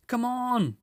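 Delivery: high, loud joyful calling; a beaver shouting the good news; excited shout. A narrator's reading. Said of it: "cried Mister Beaver".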